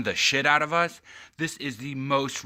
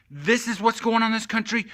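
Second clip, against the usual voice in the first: higher and louder shouted repeat; outraged louder repeat; 10 semitones higher, 6 dB louder than usual. Real, not acted. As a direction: hammer the point home shouting. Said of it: "And this is what's going on".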